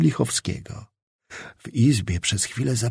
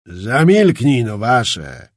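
put-on lower, slow voice of an old officer; old ensign; 4 semitones lower, 4 dB louder, slower than usual. A narrator's reading. Old ensign silencing Czapliński, lower slow gruff command. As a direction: hush him with old authority. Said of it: "rzekł stary chorąży".

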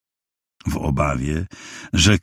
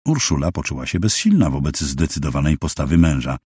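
ordinary delivery

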